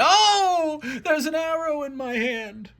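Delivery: very high, hammed-up yelp and wail; mock agony in a high voice; pained outcry. God shot in the hand, hammed-up pained wail.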